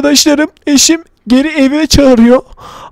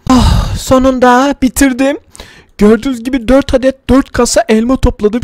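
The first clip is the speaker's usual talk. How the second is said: usual speaking voice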